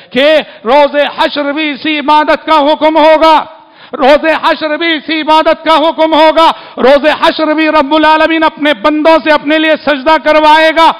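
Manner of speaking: preaching at full force. Acted. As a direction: thunder it out to the congregation. Sermon climax on Judgement Day.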